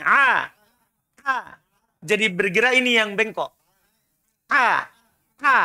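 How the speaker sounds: "A" squeezed in the mid throat as ain; repeated ain drills; ain sound. Shown as a demonstration. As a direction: constrict the throat for "A". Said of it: "Tengah tenggorokan".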